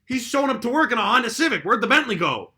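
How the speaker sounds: loud, high, disbelieving questions; mock incredulous outburst. Recruit spotting the boss's Honda Civic, incredulous outburst. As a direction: sound scandalized as the penny drops. Acting a recruit's dawning suspicion.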